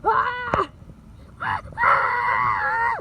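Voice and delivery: loud, high scream. Acted. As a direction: scream out at full volume. Screaming as the wind hits.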